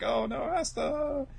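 high, drawn-out mock dismay; hammed-up slow cry of concern; mock alarm. Imitating Noah as Asta is taken, mock alarm.

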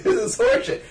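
high, indignant outburst; mock outrage at a stupid question; exasperated complaint. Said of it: "You're like".

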